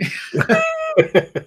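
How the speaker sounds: a high squealing laugh; real high laugh. Real, not acted. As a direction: laugh up high at your own joke. Laughs after mock cheer.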